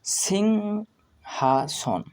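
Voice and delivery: সিংহাসন very slow, syllable by syllable, starting high; pronunciation demo. Shown as a demonstration. Teacher introduces the word to learners, সিংহাসন drawn out. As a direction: split সিংহাসন into sing, ha, son, falling. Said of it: "এর উচ্চারণ কিভাবে করতে হয়".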